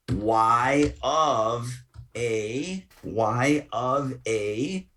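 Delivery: drawn-out words, typing along; slow dictation. Real, not acted. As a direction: stretch each word as you type it. Dictating the formula while typing.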